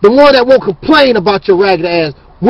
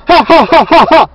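a fake scornful "ha, ha, ha" in imitation; imitated mocking laugh; 2 semitones higher than usual. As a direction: mimic them laughing at you, mockingly. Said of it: "Oh, you laugh".

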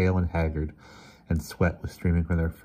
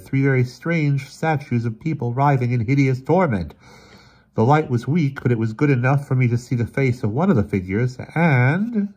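raised, loud dramatic storytelling voice; grave Emerson, urgent account; 7 semitones higher, 6 dB louder than usual. Acted. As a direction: recount a horrifying find, high and emphatic. Emerson recounts horrible discovery.

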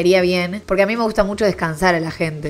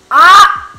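very loud, high "ah"; sudden scream; 12 semitones higher, 15 dB louder than usual. Real, not acted. Vlogger interrupting her recap with a shriek, loud and high. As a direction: break off with a piercing yell. Sudden cry while reading.

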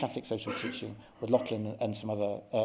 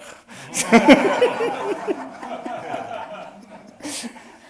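high laugh; 13 semitones higher, 9 dB louder than usual. Real, not acted. Amused at own French impression.

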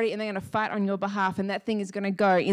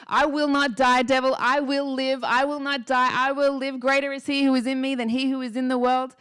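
raised, urgent declaration, short of screaming; retold scream; 3 semitones higher than usual. Woman retelling how she screamed at the devil, forceful mock yell. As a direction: retell a defiant scream with force, held back. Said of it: "screaming at the top of my lungs".

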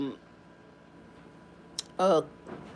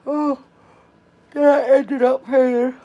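words stretched by a yawn; 8 semitones higher, 9 dB louder, slower than usual. Real, not acted. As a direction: talk through a real yawn. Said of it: "I'm tired".

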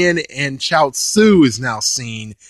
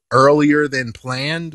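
usual speaking voice

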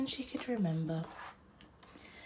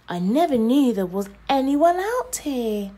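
excited; 8 semitones higher, 11 dB louder than usual. Acted. Nova's excited reply.

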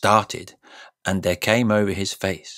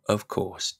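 usual speaking voice

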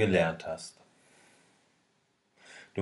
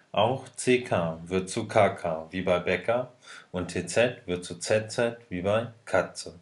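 ordinary talk, nothing marked

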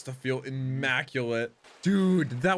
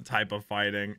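ordinary talk, nothing marked

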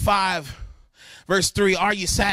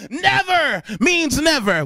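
laughing emphasis; 6 semitones higher, 4 dB louder, slower than usual. Shown as a demonstration. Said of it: "Hear me".

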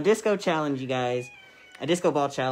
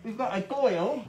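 mock-British, pitched up; high amateur British accent; attempted British accent; 7 semitones higher than usual. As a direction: introduce cats in a silly British voice. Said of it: "that accent you're doing".

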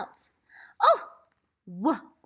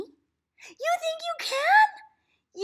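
mock doubt; 3 dB louder, much slower than usual. Teacher teasing child with doubt.

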